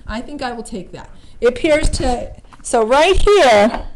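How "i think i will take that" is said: quiet repeat. Real, not acted. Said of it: "repeated quieter".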